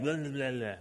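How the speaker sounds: low, soft mumbled grumbling; muttering. Shown as a demonstration. Preacher showing what the Greek word for mutter sounds like, muttering. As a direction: mutter under your breath. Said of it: "They're muttering".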